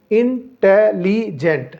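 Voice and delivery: इंटेलिजेंट said very slowly, each syllable separate. Shown as a demonstration. Teacher showing students four syllables, very slow. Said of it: "इंटेलिजेंट के चार syllable होते हैं".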